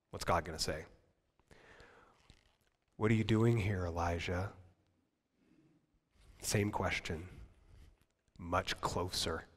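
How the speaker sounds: low, soft voice of God; hushed. Acted. God quietly asking Elijah, hushed and low. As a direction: speak it close and quiet.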